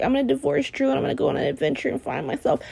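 quick, soft, airy plan; mock breezy Sam. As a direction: rattle it off like a carefree dreamer. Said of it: "when she's like".

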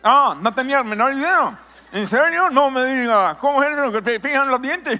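lightly voiced skeptical church member; a hint of mock disbelief; mock doubtful listener. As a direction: play a listener half-amazed at the pastor's claim. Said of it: "Algunos ahorita".

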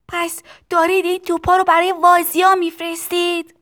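put-on high child's yell; shouted question. A narrator's reading. Tistu asking where the cannons are going, high shout. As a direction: call out loudly over machinery.

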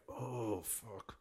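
breathy under-the-breath oh, fuck; muttered curse. Retold or imitated. Dad at breakfast chaos, cursing under his breath. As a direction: swear softly to yourself, defeated. Dad overwhelmed by kids' morning.

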